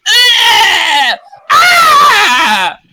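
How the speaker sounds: high, loud pained screams; dying screams. Acted. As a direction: scream as if run through. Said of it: "you hear screams".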